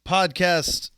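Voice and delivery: big showy podcast intro; hosting it like a radio announcer. Acted.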